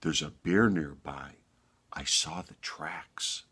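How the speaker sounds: lightly voiced low, soft shepherd; understated ominous shepherd; grave shepherd. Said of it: "And the shepherd said".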